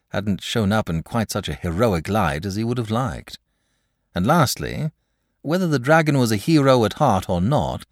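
plain reading voice

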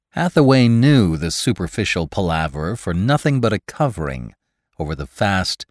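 plain reading voice

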